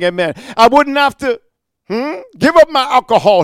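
sarcastic. Retold or imitated. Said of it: "he's being sarcastic".

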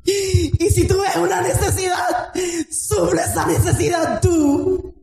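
high, impassioned sermon with shouted lines; rousing revival preacher; fiery preaching. Acted. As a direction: preach it at full force to the congregation. Preacher rising to full force.

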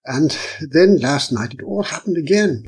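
ordinary delivery

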